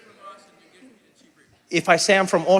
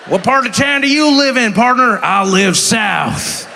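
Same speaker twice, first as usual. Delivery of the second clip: hammed-up loud frontier partner voice, drawn out at the end; old-time cowboy; 5 semitones higher, 6 dB louder, slower than usual. People still on horse and carriage, hammed-up old-time cowboy. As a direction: play two cowboys trading greetings. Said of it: "still on horse and carriage".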